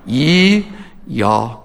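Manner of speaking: Ye, then y'all without the L; Southern "Ye, y'all". Shown as a demonstration. Native drilling one versus two people, Southern Ye, y'all. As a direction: contrast Ye and y'all, pausing between. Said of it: "Here's how you say it".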